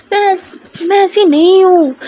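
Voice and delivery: high, drawn-out hurt insistence; earnest protest. Acted. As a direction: insist on innocence to a doubting partner. Protesting innocence to doubting partner.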